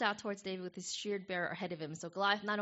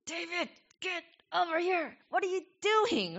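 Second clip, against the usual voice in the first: higher, louder scolding yell; furious big brother; 10 semitones higher, 5 dB louder than usual. Retold or imitated. Oldest brothers scold young David, a higher, louder furious yell. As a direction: bark at the little brother to come back. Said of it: "His oldest brothers were like".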